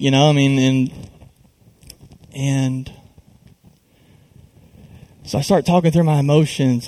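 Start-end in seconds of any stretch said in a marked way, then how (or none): none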